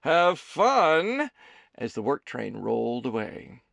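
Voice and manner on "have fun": loud, high call from a distance; shouting. A narrator's reading. Conductor shouts from the vestibule as the train leaves, a loud call. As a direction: yell it after the departing friends.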